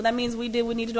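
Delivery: ordinary talk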